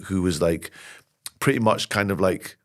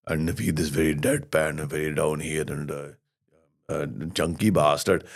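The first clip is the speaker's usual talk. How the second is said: deadpan Naveed; 6 semitones lower, 5 dB quieter than usual. Retold or imitated. Comic describing his character, in a low deadpan voice. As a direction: drop it down here, dry and deadpan. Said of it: "Naveed was a thing".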